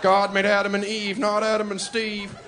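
mock a protester chanting at a rally; hammed-up protester slogan. Redneck protester voice again.

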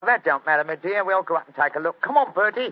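loud Cockney workman taking charge; Cockney accent. Acted. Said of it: "My Cockney accent doesn't compare with yours".

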